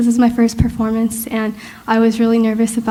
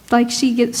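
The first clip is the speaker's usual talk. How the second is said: ordinary talk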